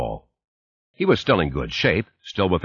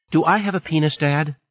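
put-on child voice; 9 semitones higher than usual. A narrator's reading. Little girl asking father.

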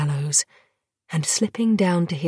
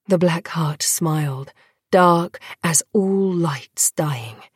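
ordinary delivery, nothing marked

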